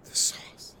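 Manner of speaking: a hushed, playful echo; whispered echo. Acted. Cohost whispers back the sauce treatment line.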